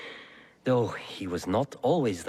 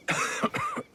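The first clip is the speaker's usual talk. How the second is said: a rough, hacking cough from a poisoned man; harsh sick cough. Acted. Poisoned lord coughing while welcoming guests, harsh sick cough. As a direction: cough through the sickness, wheezing.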